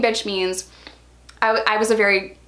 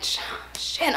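whispered curse; 6 semitones higher, 8 dB quieter than usual. Retold or imitated. Panicked curse when cops mentioned.

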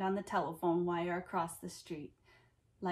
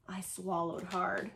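ordinary delivery, nothing marked

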